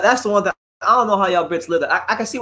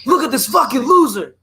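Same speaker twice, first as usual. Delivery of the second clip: BFTV Boxing impression; 8 semitones higher than usual. Streamer mocking a boxing YouTuber, high mock rant. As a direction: sneer it like a boxing YouTuber trashing a guest. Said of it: "I can do a B F T V boxing impression".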